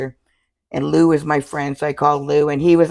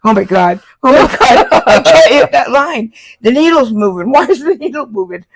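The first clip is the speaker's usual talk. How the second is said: loud, high mock panic; panicked; 9 semitones higher, 9 dB louder than usual. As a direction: spiral into panic over the line. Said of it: "And I'm going".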